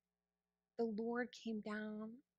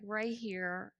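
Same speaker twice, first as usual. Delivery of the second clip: usual speaking voice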